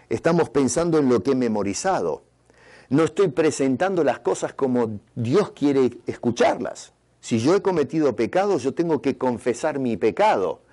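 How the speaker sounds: ordinary talk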